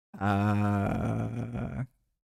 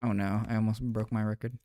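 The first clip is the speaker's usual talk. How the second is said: usual speaking voice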